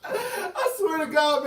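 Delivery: laughing it out, slow and high. Real, not acted. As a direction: crack up mid-sentence while swearing it's true. Cracking up retelling her refusal.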